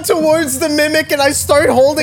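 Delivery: high, breaking into laughter; laughing through the words. Real, not acted. Player laughing at own doom.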